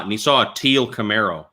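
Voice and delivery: usual speaking voice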